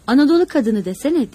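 pleased and proud remark. A narrator's reading. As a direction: say it with open pride. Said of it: "kıvançlı bir sesle".

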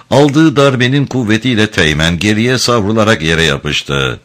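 ordinary delivery

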